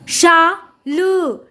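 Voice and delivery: शाल very slowly, as शा … ल; syllable by syllable. Shown as a demonstration. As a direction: sound out शाल in two separated parts. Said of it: "जोड़कर पढ़ेंगे".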